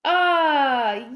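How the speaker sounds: ordinary talk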